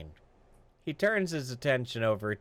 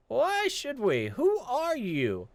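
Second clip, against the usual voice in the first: high, slow, taunting guard voice; mocking guard; 12 semitones higher, slower than usual. Guard stonewalling Jonas at the gate, high and taunting. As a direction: stall him with fake ignorance.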